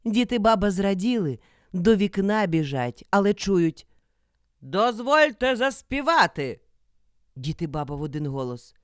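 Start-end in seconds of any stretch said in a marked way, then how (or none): 4.6-6.6 loud, higher-pitched call; wolf calling out. A narrator's reading. Wolf asks the old couple to sing, calling out.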